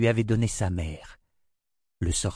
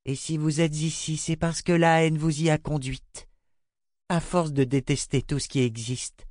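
lightly voiced girl, cold and firm; 7 semitones higher than usual. A narrator's reading. Girl coldly condemning the queen.

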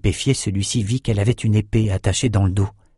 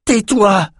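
sharp, much higher cry; shouted order; 11 semitones higher, 3 dB louder than usual. A narrator's reading. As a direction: snap back at the skull with a yell. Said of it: "cria la Mauracite".

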